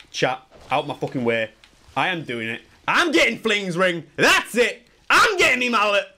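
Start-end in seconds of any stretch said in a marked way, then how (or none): 2.9-6.1 loud, high, triumphant announcement; fired-up, raised voice; hyped declaration. Real, not acted. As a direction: announce it to chat at high pitch.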